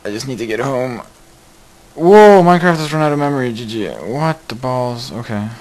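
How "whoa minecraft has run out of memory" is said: loud, high, fast burst of alarm; startled outcry. Real, not acted. Streamer yelping at viewers as the game crashes, loud and high. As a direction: blurt it out as the game dies.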